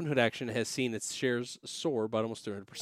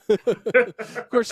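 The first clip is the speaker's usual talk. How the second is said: laughing; 9 semitones higher, 7 dB louder than usual. Real, not acted.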